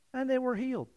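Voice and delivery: high, soft, offhand reading; mock-casual understatement in a high, soft voice; calm understatement. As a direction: read it lightly, as if it were no big deal. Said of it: "says it so calmly, so quietly".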